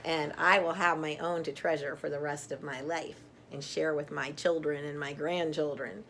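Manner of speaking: plain reading voice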